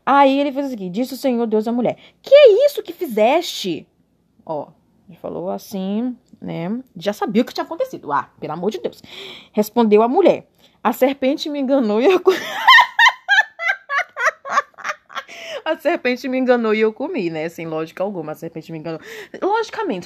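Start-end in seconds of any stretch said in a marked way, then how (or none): 2.2-3.9 high, loud, slow questioning; high loud God voice. Acted. God questioning the woman, high, loud and slow. 10.8-12.3 reading that collapses into a laugh; breaking into laughter. Real, not acted. Reading the Bible while drunk, laughing mid-line. 12.4-15.6 loud, high cackle; high burst of laughter. Real, not acted. Drunk reader cracking up at Eve's excuse.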